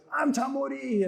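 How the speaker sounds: high-pitched TV host announcing himself; Tamori. Retold or imitated. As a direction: imitate a showy on-air self-introduction.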